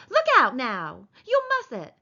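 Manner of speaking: sharp, rising scold; annoyed warning. Acted. Wife warning the man stroking too hard, annoyed.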